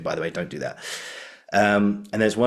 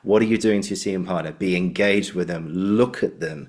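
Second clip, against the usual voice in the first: ordinary talk, nothing marked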